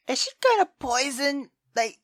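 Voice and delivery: normal talking voice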